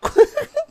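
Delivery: risita aguda; risa aguda. Real, not acted. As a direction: se ríe agudo. Risa tras el chiste. (risita aguda)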